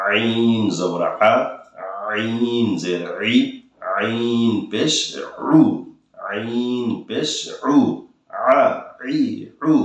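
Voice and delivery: আ ই উ very slow with throat pressure; pressed ain. Shown as a demonstration. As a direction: squeeze আইন from the throat, slowly. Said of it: "দেখুন আমি কীভাবে চাপ দিয়ে পড়তেছি". (গলায় চাপ দিয়ে ধীরে আইন)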